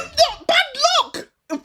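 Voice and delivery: very high, loud outraged denial; indignant shout. Real, not acted. Fan denying his friend's jinx accusation, indignant shout. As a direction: yell it back in protest. Teased as being bad luck.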